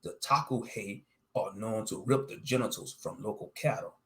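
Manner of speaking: plain reading voice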